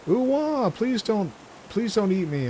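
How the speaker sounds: high, frightened begging voice; scared pleading. Acted. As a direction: beg not to be eaten. Same frightened character again.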